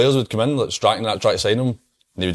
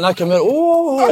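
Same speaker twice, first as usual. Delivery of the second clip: laughing teasing mimic; 4 dB louder, slower than usual.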